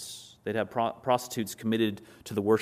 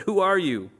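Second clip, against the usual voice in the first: loud, high startled question; 8 semitones higher, 6 dB louder than usual. Acted. Boaz finding a woman at his feet, startled. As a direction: blurt it out, pitch jumping up in alarm. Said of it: "probably quite startled because of this".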